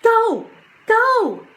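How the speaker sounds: loud, high barked commands; shouting. A narrator's reading. Jack shouting the wolves away, loud high yell. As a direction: yell each word hard. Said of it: "shouted Jack".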